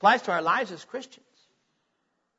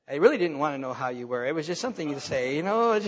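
lower, quick, offhand mutter of someone who never cared; dismissive inner voice; 7 semitones lower, 4 dB quieter than usual. Retold or imitated. Pastor voicing a bored greeter's honest thought, offhand and lower. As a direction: toss it off like a polite lie exposed. Imitating a reluctant churchgoer's thought.

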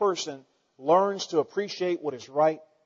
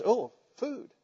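dog's voice; 6 semitones higher, 4 dB quieter than usual. Pretend. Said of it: "the dog looks at it and says".

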